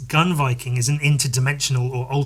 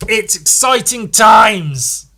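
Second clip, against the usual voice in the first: hammed-up excitement; 8 semitones higher, 9 dB louder, much slower than usual. Real, not acted. Closing excited announcement.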